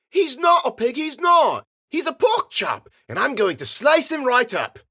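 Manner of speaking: put-on very high, loud child's protest; bratty shouting girl. A narrator's reading. Girl quarrelling with her sister over the catch, shouting. Little girls quarrel, loudly.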